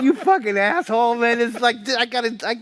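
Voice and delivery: high, loud laughing rant; laughing mock outrage. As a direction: yell at him while cracking up. Retold drunk outburst at brother.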